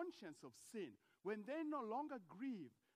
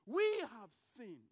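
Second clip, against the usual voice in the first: "We have sinned" much louder and slow, each word weighted; loud slow confession; 11 dB louder, much slower than usual. Pretend. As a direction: proclaim "We have sinned" slowly and loudly. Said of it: "comes before God ... and says".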